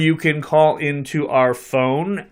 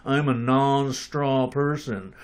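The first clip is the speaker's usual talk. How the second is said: jaw jutted out, slow and lower; underbite; 3 semitones lower, 5 dB quieter, slower than usual. Shown as a demonstration. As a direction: push the jaw forward and drawl it out. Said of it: "you have to take on the accent".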